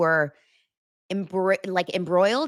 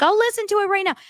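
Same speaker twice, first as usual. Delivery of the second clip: eager high voice; 3 dB louder, faster than usual. Retold or imitated. Eager promise to catch up.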